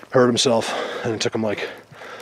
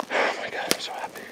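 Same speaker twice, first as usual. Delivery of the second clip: whispering; 11 dB quieter than usual. Real, not acted. Hushed joy at finding signs.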